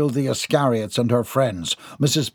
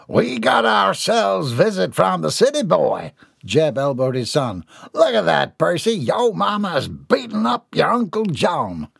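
amused country father; 6 semitones higher than usual. Acted. Jeb points out the fight to his son, teasing. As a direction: rib your son about the fight.

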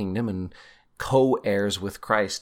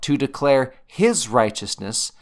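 HIS raised and held before "righteousness"; stress on HIS; 2 semitones higher, 4 dB louder than usual. Shown as a demonstration. Teacher cheating the verse to bring out HIS, stressed. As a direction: lean hard on the word "his". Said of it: "emphasize the word I wanna bring out".

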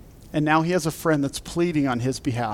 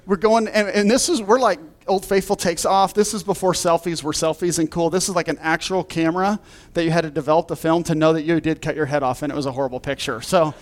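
usual speaking voice

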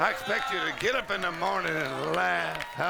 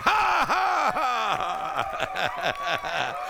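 high, loud forced "ha, ha" falling away; triumphant deliberate ha-ha; defiant laugh; 5 semitones higher, 4 dB louder, much slower than usual. Shown as a demonstration. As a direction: laugh on purpose at the devil. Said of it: "That's why I'm laughing".

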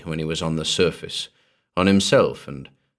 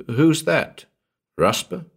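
raised-pitch, startled challenge; demanding; 8 semitones higher, slower than usual. A narrator's reading. Man roused in his coffin to a caller above, demanding. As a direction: demand to know who is there. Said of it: "Walters demanded".

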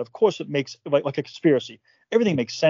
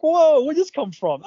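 much higher, slow, louder disbelief; mock shock; 12 semitones higher, 4 dB louder, slower than usual. Reacting to the bookkeeper's sudden insult, shocked. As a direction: act floored by it.

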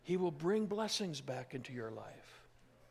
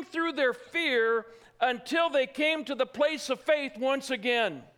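loud, high, impassioned preaching; building sermon fervor; full-force preaching; 8 semitones higher, 8 dB louder than usual. Acted. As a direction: preach it hard to the congregation.

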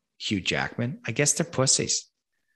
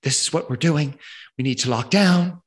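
higher, louder, all hyped up; urgent war-room announcement, higher and louder; 5 semitones higher, 5 dB louder than usual. Retold or imitated. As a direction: play the fired-up leader announcing lockdown. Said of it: "like, all hyped up".

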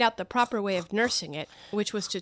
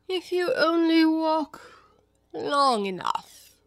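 slow, stretched cat voice, starting high; Cheshire Cat; 7 semitones higher, 3 dB louder, much slower than usual. Acted. The cat adds advice to Alice, languid. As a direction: draw it out and let it sink.

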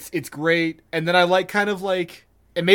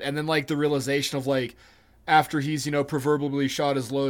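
normal talking voice

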